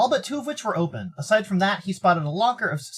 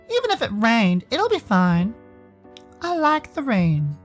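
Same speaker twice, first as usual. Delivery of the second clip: slow, higher-pitched reserved character voice; Sal; 7 semitones higher, slower than usual. Acted. Sal chatting with Dave about weather, in slow character voice. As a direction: draw it out gently, in character.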